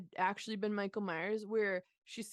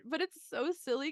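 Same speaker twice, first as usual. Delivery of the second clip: ordinary talk, nothing marked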